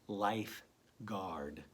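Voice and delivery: Lifeguard stretched out very slowly; teacher slowly blending Lifeguard for a child. Shown as a demonstration. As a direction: draw out Lifeguard syllable by syllable. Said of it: "Three sounds in the first part".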